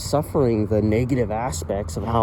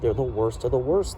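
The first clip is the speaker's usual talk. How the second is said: ordinary delivery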